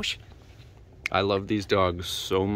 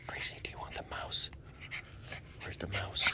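whisper; 18 dB quieter than usual. Real, not acted. Whispered teasing.

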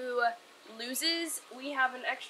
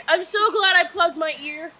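normal talking voice

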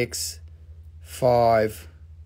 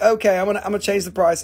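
ordinary talk, nothing marked

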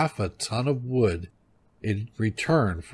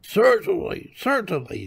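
put-on high old-wife voice, falling on each word; high, dismissive wifely reply; eating old wife; 9 semitones higher than usual. A narrator's reading. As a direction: agree high-voiced between handfuls. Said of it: "she replied, helping herself to another handful".

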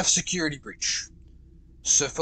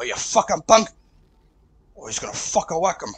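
ordinary delivery

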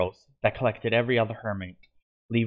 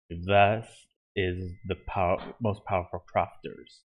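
low, slow menacing villain voice; villain chuckling; 4 semitones lower, slower than usual. A narrator's reading. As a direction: gloat down at the heroes. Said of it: "he chuckled".

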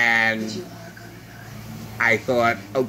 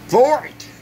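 mocked New York accent; 7 semitones higher than usual. Vlogger imitating a man mocking the president's accent, high mock New York. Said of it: "he had a New York accent".

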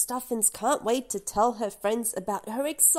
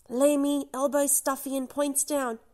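usual speaking voice

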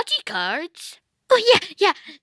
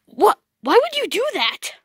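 normal talking voice

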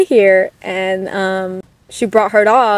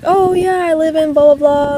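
bright retold reply; 7 semitones higher than usual. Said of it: "And so I was like".

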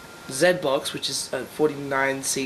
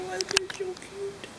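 cooing; 9 dB quieter than usual. Real, not acted. Cooing at sleeping pet.

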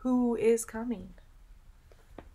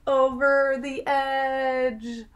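suspenseful slow; 3 semitones higher, 5 dB louder, much slower than usual. Acted. Suspenseful moment in story.

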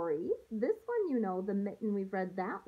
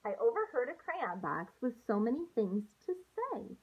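put-on gentle storybook read-aloud for young students; picture book read to a class; reading to children. Teacher reads picture book aloud.

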